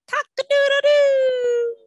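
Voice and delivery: high loud crowing cock-a-doodle-doo; rooster crow. Shown as a demonstration. Teacher showing the rooster sound to children, loud crow.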